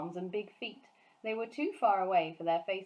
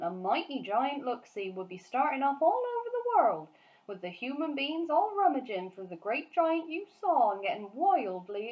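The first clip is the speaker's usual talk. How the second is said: higher, louder, animated giant; BFG's voice; 6 semitones higher, 5 dB louder than usual. Acted. Giant warning Sophie about giant hunts, animated giant voice. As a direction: rattle on like the excited friendly giant. BFG long speech.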